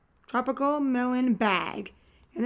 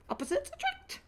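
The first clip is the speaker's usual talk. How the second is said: singsong saying; 9 dB quieter than usual. Pretend. Quoting a saying playfully.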